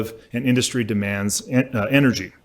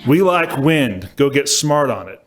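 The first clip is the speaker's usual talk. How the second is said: put-on George W. Bush Texan accent; 4 dB louder than usual. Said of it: "apologize for the accent here".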